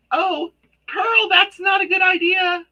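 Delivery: loud startled call to the cat; shout at the cat. Real, not acted. Cat climbing onto her light.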